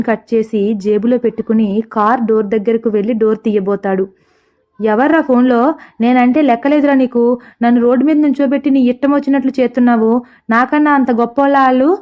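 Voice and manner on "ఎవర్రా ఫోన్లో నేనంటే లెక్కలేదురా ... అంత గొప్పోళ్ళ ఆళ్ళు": usual speaking voice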